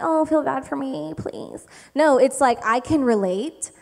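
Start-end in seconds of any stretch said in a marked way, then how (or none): 0.0-1.7 mock self-pity. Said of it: "this is not me being like".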